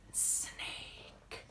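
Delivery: whispered, long sibilant "Snake"; hissing snake whisper. Pretend.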